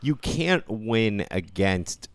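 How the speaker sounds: ordinary talk, nothing marked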